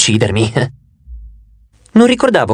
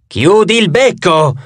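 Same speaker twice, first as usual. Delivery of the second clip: mild, drawn-out narrated yell; exasperated cry, slow and high; 4 semitones higher, slower than usual. A narrator's reading. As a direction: raise the pitch and stretch it, short of shouting. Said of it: "urlò la faccia destra".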